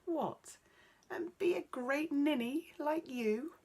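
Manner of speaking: mock the prince to his face; mocking laugh-off. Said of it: "But Ella only laughed".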